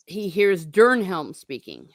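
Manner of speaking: plain reading voice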